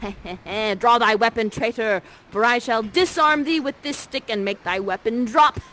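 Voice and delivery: loud, slow Shakespearean challenge; theatrical challenge. Acted. Prospero challenging Ferdinand to a duel, loud and grand. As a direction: declaim it like a stage duel. Acted sword fight challenge.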